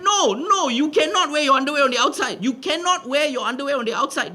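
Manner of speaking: high-pitched acted refusal, laying down the law; scolding Indian auntie. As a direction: forbid it like an exasperated auntie. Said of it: "the only thing an Indian auntie would do".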